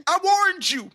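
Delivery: put-on high-pitched squealed kid rant; acted-out child tantrum, pitch way up; indignant outburst. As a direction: protest shrilly, as if wronged by mom. Child's angry comeback to mom, acted.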